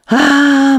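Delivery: loud, high hammed-up sigh; tragic sigh. Zeev sighing to the narrator, hammed-up. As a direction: heave a theatrical sigh. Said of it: "tragic sigh he gave next".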